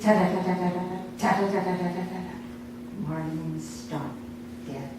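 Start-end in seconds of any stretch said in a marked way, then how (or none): none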